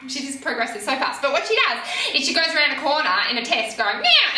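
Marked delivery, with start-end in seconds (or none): 4.0-4.4 much higher, loud mock yeah; imitating a happy careless rider; carefree whoop. Rider cutting corner carelessly.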